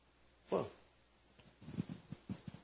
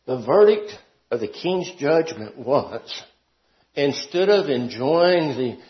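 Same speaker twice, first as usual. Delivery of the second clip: normal talking voice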